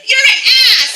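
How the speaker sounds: high, loud shriek of outrage; hammed-up shrill yell; angry girlfriend. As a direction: scream it like a furious girlfriend.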